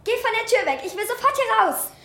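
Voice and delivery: high, tense insistence; scared demand. Acted. Eileen ordering the stranger from the door, scared. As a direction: push him away in panic. Girl panics at intruder.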